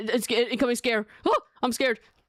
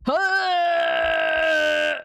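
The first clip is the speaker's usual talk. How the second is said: long, loud, high scream; drawn-out shriek at a scare; startled scream; 8 semitones higher, 6 dB louder than usual. Real, not acted. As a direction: scream out in fright on one held note. Scare in the horror game.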